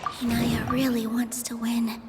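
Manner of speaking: slow, breathy whispered aside. Acted. Nilou privately steeling herself mid-debate, slow whisper. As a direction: whisper it to yourself, slowly.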